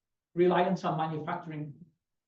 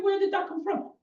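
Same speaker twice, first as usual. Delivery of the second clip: surprised; 11 semitones higher than usual. Retold or imitated. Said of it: "I was like".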